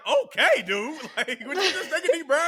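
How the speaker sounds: laughing retort. Retold or imitated. Quarterback answering his teammate's scolding, high laughing protest. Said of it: "I'm just like".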